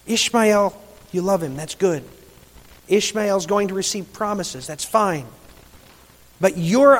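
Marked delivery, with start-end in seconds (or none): none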